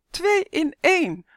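high, loud, drawn-out cheer; children jeering with glee; gleeful whooping. A narrator's reading. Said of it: "joelden ze vrolijk".

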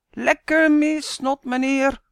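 high, loud slow taunting shout; mock the missed throw; gleeful taunt. A narrator's reading. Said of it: "roept Sander". (uitdagend en luid roepen)

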